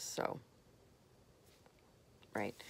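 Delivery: normal talking voice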